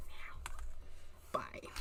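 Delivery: soft voiceless whisper. Real, not acted. Crafter whispering as she throws out garbage, hushed. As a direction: whisper it like a secret.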